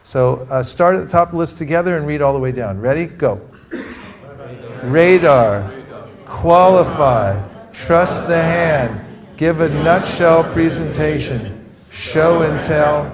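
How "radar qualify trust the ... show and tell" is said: slow, deliberate call-out for group repetition; leading a recital. Shown as a demonstration. Teacher reading the list aloud with the class, slow and deliberate. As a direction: stretch each phrase so everyone can join in.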